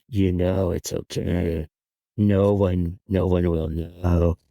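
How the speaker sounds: low, slow inner voice; voice of conscience. Acted. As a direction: murmur temptation from inside someone's head, low and drawn out. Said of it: "Jan's conscience was talking to her".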